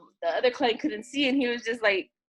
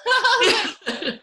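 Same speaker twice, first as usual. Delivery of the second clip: laughing; 11 semitones higher, 8 dB louder, much slower than usual. Real, not acted. Laughs recalling the moment.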